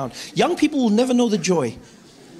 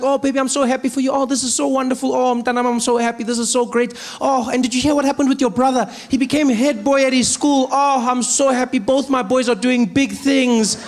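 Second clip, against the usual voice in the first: higher, gushing mother voice; mom voice; 6 semitones higher than usual. Retold or imitated. Mom proud of both sons, higher gushing voice.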